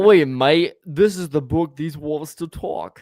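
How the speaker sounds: put-on playful Aussie accent, loud on Oi mate; Australian accent, drawn out at the end. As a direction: ham up an Australian bookseller. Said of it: "That's your new voice".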